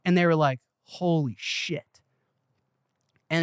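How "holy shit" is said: drawn-out, hushed mock amazement; stunned awe. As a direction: imitate listeners slowly taking it in. Retold stunned reaction to the pitch.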